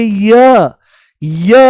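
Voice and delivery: "य" sounded out loud and alone so the ee glide is heard; demonstrated ya sound. Shown as a demonstration. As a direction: hold up "य" as a clear sample sound for students. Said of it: "ध्यान से सुनने की कोशिश करो".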